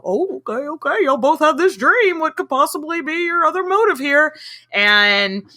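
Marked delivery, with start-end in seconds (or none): 0.0-4.4 mock dim-witted emperor, higher voice; Claudius impression. Podcast host imitating Claudius swallowing the dream story. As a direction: play Claudius as a gullible fool. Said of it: "That was an excellent Claudius impression".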